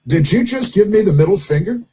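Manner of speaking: mock disbelief; incredulous quote. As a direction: act out a taken-aback question. Quoted reaction to being flipped off.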